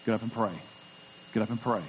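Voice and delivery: low, soft voice of God calling; quiet inner voice. Retold or imitated. Pastor voicing God's call to rise and pray, soft and low. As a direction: murmur it like a voice heard at dawn. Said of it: "I'd hear that voice".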